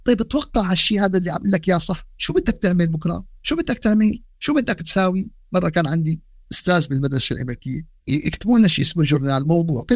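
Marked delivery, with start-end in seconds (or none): none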